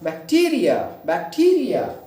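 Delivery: Bacteria with TEE stressed; bacteria said bak-TEE-ria, rising then falling; stress on second syllable. Shown as a demonstration. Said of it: "टीई पर हम क्या करेंगे".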